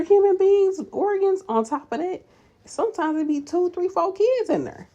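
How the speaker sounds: high, loud marvelling; amazed emphasis. Real, not acted. Nurse marvelling at pregnant bodies, high excited emphasis. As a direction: voice climbs way up in amazement.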